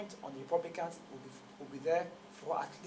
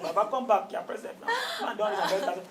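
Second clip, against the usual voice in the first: pleading; 5 semitones higher, 10 dB louder than usual. Retold or imitated.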